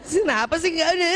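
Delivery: whimpering, half-laughing fake cry; mock tearful complaint. Woman crying alone while changing a tyre, mock tearful grumbling. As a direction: complain through put-on sobs. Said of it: "Udah kayak gitu sambil ngomong pula".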